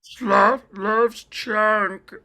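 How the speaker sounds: very slow, high, strained Sloth voice; super slow sleepy sloth. Retold or imitated. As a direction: drag out each word like Sloth. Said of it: "my sloth impression".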